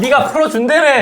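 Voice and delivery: laughing angry shout; hammed-up furious yell; mock yell. Acted inmate yelling at lawyer. (웃음 섞인 고함)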